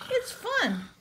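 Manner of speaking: very high, eager squeal; SpongeBob. A narrator's reading. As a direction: coax in a high cartoon voice. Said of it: "asked SpongeBob".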